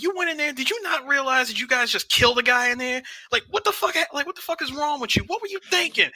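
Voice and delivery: high, fast exasperated yell at the son; mock-furious father scolding; angry dad yelling. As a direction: chew him out like a furious father.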